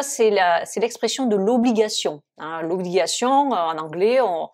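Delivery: ordinary talk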